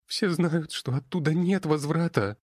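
put-on high, tearful girl's voice; sobbing girl. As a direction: sob the words out. Crying girl continues.

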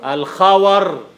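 الخور spelled out slowly as al-khawar, open a on the waw; slow teaching of الخور, khawar not khawr; drawn-out الخور with fatha on waw; careful pronunciation. Shown as a demonstration. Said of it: "خور فتح الواو".

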